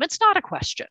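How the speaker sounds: ordinary delivery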